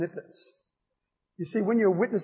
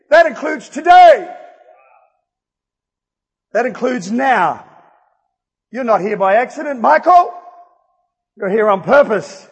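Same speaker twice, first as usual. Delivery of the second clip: loud, higher, emphatic preacher delivery; fired-up preaching; 4 semitones higher, 9 dB louder than usual. Real, not acted. Preacher declaring to Michael you're not here by accident, fired-up. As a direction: proclaim it to the whole room with punched key words.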